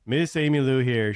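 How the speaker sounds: normal talking voice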